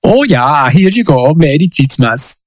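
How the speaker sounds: a light, hammed-up Dutch accent; faintly voiced Dutch accent, half joking; mock Dutch accent. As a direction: put on a silly Dutch accent for a Christmas gift line. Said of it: "Please don't take offense, my Dutch people".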